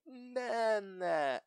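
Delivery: "Na, na" high and drawn out; exaggerated final emphasis. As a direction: lean hard on each "na", stretching it in mockery. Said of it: "so much emphasis on the end".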